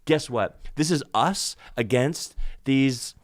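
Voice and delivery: building from plain to a loud, slow rant; mock rallying cry against fragile men, rising to loud; mock war cry. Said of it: "if you wanna say".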